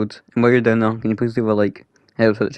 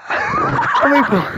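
shrieking laugh; 3 dB louder, much slower than usual. Real, not acted. Shrieking laugh at friend's death.

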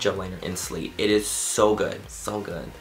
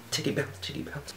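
whisper; 5 semitones higher, 9 dB quieter than usual. Real, not acted. Silly playful aside.

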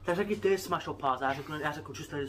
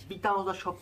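ordinary delivery

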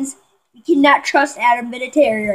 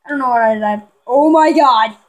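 ordinary talk

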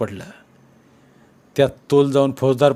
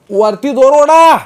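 high, loud yell from down below; 13 semitones higher, 13 dB louder, slower than usual. A narrator's reading. Boys in the well yelling up to the crowd, loud and high. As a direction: bellow it up from the bottom of the well. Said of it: "त्यांनी खालनंच बोंब ठोकली".

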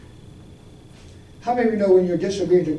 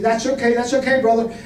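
anxious pastor; 5 semitones higher, 5 dB louder than usual. Retold or imitated. Pastor anxiously stopping him.

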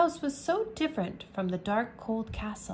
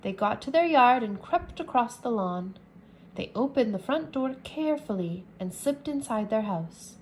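ordinary delivery

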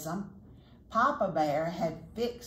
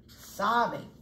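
ordinary delivery, nothing marked

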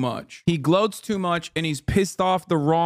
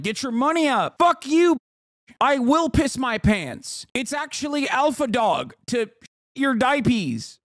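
mocking twelve-year-old; 8 semitones higher than usual. Streamer mocks Tate fans with a high bratty voice. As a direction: parody a boy boasting, much higher. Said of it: "twelve-year-olds are running around being like".

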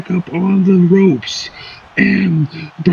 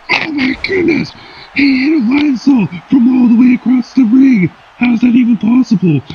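amazed commentator; 7 semitones higher, 3 dB louder than usual. Acted. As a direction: shout your disbelief at the move.

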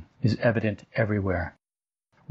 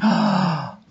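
awed gasp; 8 semitones higher, 4 dB louder than usual. Acted. Sudden wonder at a leaf.

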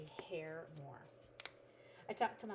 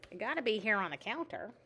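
louder, swooping voice; louder, with pitch jumping about; sudden odd voice; 3 semitones higher, 8 dB louder than usual. Real, not acted. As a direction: slip into a funny voice mid-sentence. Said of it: "I just got that voice".

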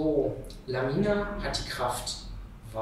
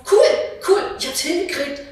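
viel höher und lauter, kreischend vor Freude; Jubelschrei; 11 dB louder than usual. Acted. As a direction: triumphierend jubeln. Shira jubelt über gelungene Teleportation. (Shira jubelt kreischend über ihren Erfolg)

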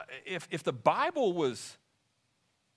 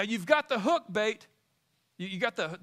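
ordinary talk, nothing marked

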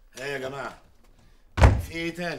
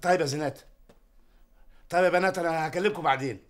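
flustered loud; 3 semitones higher, 8 dB louder, much faster than usual. Real, not acted. Father abruptly ends call.

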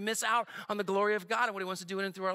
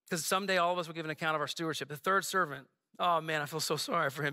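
usual speaking voice

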